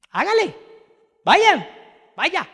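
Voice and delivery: very high, loud, scoffing go on then; mocking laugh-off. Micaías laughing off the four hundred prophets, high and mocking. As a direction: toss it off with amused scorn. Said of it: "él simplemente se reiría y dije".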